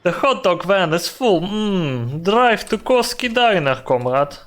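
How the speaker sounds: put-on Russian gangster accent. Boss sending the hot dog van to the diner, Russian accent. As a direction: send a comrade off in a Russian accent. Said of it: "have to cosplay Russian accent".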